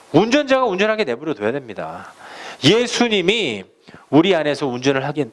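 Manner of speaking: ordinary talk, nothing marked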